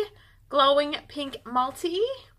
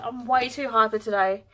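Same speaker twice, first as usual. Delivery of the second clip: normal talking voice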